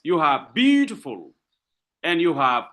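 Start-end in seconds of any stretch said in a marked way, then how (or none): 0.5-1.3 "beautiful" with a strong first syllable, two light ones after; stress on first syllable. Shown as a demonstration. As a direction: punch the "beau" in "beautiful". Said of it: "stress syllable followed by two that are not stressed".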